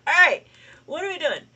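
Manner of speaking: high, fast, laughing and loud. Real, not acted. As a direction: talk through the giggles. Laughing, flustered restart.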